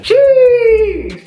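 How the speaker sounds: a very high, loud squeal of shock; shocked shriek. Real, not acted. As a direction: shriek it as if the shoes gave you a fright. Shouts at the shoes.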